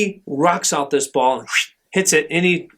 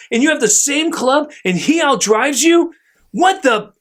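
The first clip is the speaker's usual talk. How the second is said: angry dad; 7 semitones higher than usual. Retold or imitated. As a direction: tear into the kid like a furious sports dad. Angry dad berating his son.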